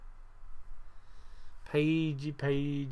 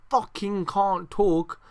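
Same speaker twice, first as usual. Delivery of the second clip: slow, louder frustrated curse; higher, exasperated self-rebuke; annoyed at himself; 4 semitones higher, 5 dB louder, much slower than usual. Real, not acted. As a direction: curse your own tongue, each word drawn out. Annoyed at own stumbles.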